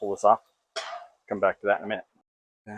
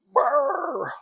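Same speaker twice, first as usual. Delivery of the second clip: high brr sound; 6 dB louder than usual. Pretend. Imitating boiling noise.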